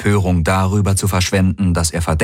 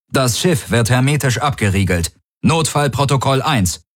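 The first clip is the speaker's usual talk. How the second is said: higher-pitched emergency order; commanding, hidden grief; 7 semitones higher than usual. A narrator's reading. Tari seals the ship by comm, commanding over hidden grief. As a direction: bark the order, hiding the sorrow. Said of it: "befehlenden Bellen den Kummer rauszuhören".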